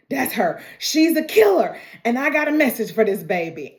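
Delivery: retold frantic accusation; imitated berserk man. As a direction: act out a man going berserk. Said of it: "he's going berserk".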